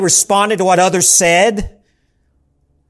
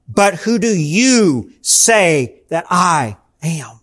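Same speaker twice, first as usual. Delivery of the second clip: slow questioning; 4 semitones lower, much slower than usual. Acted. Jesus' question voiced slowly.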